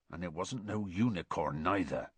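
low, soft and grim; dark, subdued certainty. A narrator's reading. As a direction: state it grimly, voice dropped. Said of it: "said Hagrid grimly".

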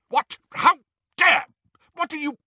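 very high, loud indignant sputter; outraged. A narrator's reading. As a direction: splutter in shocked outrage. Portrait outraged at being blindfolded.

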